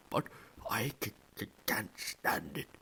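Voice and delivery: muffled, halting tearful words; sobbing Hagrid. A narrator's reading. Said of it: "burying his face in it".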